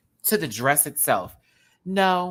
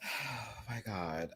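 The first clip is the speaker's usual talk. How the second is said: slow, much softer sigh; 2 semitones lower, 14 dB quieter, slower than usual. Real, not acted. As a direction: let it out tired and soft. Exhausted after laughing.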